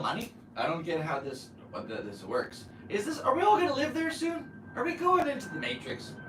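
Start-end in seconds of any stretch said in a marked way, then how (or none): 2.9-6.1 disbelief. Real, not acted. Escalating disbelief about the metaverse.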